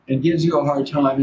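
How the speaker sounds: normal talking voice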